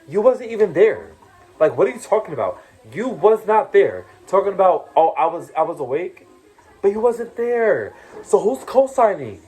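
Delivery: high, loud, indignant; angry rebuttal. Real, not acted. Scolding Raquel for speaking on what she missed, high and loud anger. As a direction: slam the accusation back at her.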